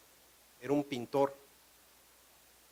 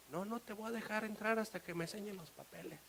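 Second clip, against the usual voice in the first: high but soft refusal; unmoved official; 6 semitones higher, 11 dB quieter than usual. Acted. Customs officer unmoved by painter's protest, soft refusal. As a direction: refuse softly, in a raised pitch.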